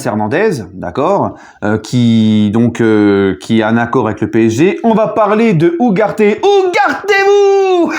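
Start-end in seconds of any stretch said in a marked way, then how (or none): 6.2-7.9 very high, slow drawn-out cry, like "écartez-vous"; hammed-up showman yell; mock shout. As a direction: belt the pun out, stretching every syllable. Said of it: "pas terrible, là".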